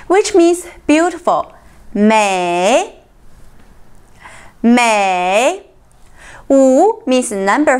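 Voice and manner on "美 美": "美" dipping, then rising, drawn out; third tone. Shown as a demonstration. Teacher drilling the word for beautiful, falling-rising third tone. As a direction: let "美" go down and then up. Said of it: "美 is a third tone".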